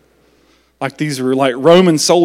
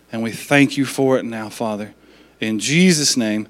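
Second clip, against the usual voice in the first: prayer; 4 semitones lower than usual. Acted. Pastor leads closing prayer.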